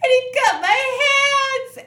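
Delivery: high, loud, hammed-up crying; imitating the victim sobbing about her hands; mock sobbing victim. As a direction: wail it like a hysterical victim in a hospital bed. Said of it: "And she's like".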